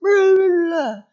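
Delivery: slow, hammed-up angry shout; belligerent, loud. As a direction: imitate a stressed kid lashing out. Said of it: "belligerent, loud, exhausted, stressed at school".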